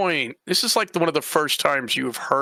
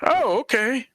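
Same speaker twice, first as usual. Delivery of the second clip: taken aback; 7 semitones higher, slower than usual. Acted. Reacting to the answer.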